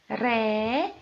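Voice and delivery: "Ré" held long on its own; stressed syllable isolated. Shown as a demonstration. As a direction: stretch out "Ré" as the strong syllable. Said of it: "a sílaba tônica é a última".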